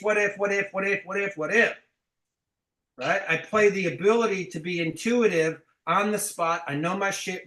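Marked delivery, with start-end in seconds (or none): 0.0-1.7 a light mock of a nervous rep; mocking fearful rep. Coach mocking fear-based reps with a light nervous repeat. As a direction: parrot the worrier, lightly. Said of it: "I don't play the fear-based".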